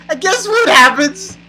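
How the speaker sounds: high, rising teaser question; mock suspense. Real, not acted. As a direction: tease it with exaggerated anticipation. Mock suspense before the result.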